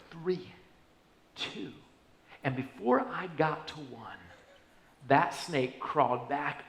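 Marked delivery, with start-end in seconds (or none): 0.1-1.7 softer, tense countdown held under the breath; hushed countdown. Retold or imitated. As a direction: count down quietly so the snake is not startled. Whispered countdown over the snake.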